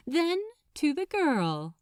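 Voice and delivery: plain reading voice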